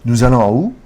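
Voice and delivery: loud, with "où" gliding upward; rising intonation. Shown as a demonstration. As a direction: raise "où" to make it a question. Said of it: "simply just rising the intonation".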